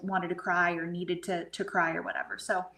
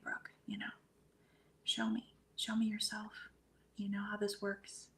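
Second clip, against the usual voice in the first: soft, hushed plea, retold; tearful plea, retold; 9 dB quieter than usual. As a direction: quietly retell pleading with someone who has died. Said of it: "connecting and I'm crying. And I'm saying".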